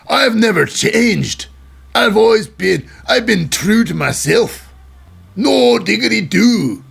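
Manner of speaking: big throaty Scottish impression; Drew McIntyre. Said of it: "I gotta stop doing that accent".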